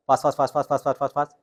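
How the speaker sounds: Fast rattled off quick and clipped; fast drill. Shown as a demonstration. Coach demonstrates the fast drill for students. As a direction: fire off each fast rapidly. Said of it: "उसी tone के साथ बोलना है".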